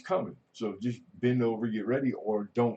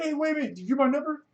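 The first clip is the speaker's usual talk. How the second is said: much higher, mock startled calling out; hammed-up surprise, much higher; mock surprised call; 4 dB louder, much faster than usual. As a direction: act shocked and call after them.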